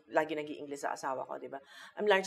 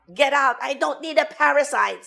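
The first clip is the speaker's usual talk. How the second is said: high, loud dramatic outburst; hammed-up melodrama shout; Maricel Soriano; 9 semitones higher, 9 dB louder than usual. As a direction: throw them out like a movie diva. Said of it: "Sabi ni Maricel Soriano".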